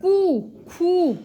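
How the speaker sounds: put-on teacher stretching कू, खू for kids; slow drawn syllables. Shown as a demonstration. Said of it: "same mix होके बोला जाएगा".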